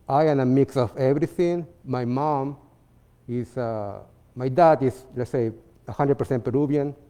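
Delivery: usual speaking voice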